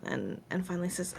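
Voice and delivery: usual speaking voice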